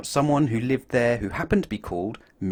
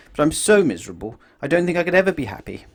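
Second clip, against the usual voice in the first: higher and louder, rushed, mock woe; mock miserable; 6 semitones higher, 4 dB louder, faster than usual. Mister Miserable tells Mister Happy he'll never be happy, in hammed-up misery. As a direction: wail it like a sulky child. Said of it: "he added miserably".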